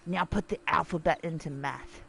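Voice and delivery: devil whispering. Acted. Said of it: "he's whispering into God's ear".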